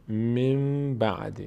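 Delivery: "من بعد" slow, the N turned into a long M; nun pronounced as mim. Shown as a demonstration. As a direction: chant "من بعد" as "mim ba'd" with a held hum.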